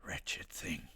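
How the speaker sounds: eerie voiceless whisper; character whisper. Pretend. Streamer performing her cameo line for Daisy, in a whisper. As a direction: hiss it out as the game creature. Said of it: "Incredible acting".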